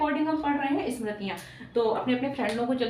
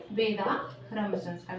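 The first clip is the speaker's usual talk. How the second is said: very slow, lower, drawn-out repeat; slow dictation; 4 semitones lower, 4 dB quieter, much slower than usual. Real, not acted. Teacher answering own question for the class, slow dictation. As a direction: stretch the term out for note-takers.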